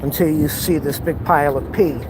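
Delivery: usual speaking voice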